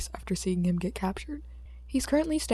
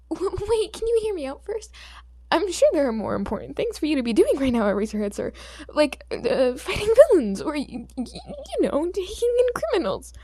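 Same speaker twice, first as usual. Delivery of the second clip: stammering plea; 8 semitones higher, 5 dB louder than usual. Acted. Boy pleads stammering to hero.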